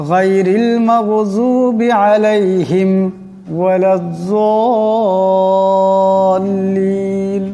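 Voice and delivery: slow chanted recitation, last word sung long; slow melodic tilawah; recitation demo. Shown as a demonstration. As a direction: chant it slowly, stretching ওয়ালাজ-যাল্লিন. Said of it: "কিন্তু আসলে গুণনা করা যাবে না".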